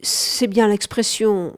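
plain reading voice

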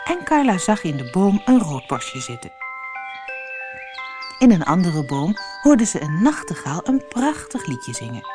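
plain reading voice

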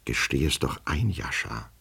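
soft, hushed confiding; whispering. A narrator's reading. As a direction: lean in and say it under your breath. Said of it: "begann er flüsternd mit wackelndem Kopf". (geflüstert, vertraulich)

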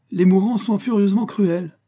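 high, loud, lightly amused retort; amused, laughing manner. A narrator's reading. Émile joking about Valentin's death wish, amused. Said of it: "dit Émile en riant".

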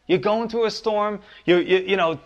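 usual speaking voice

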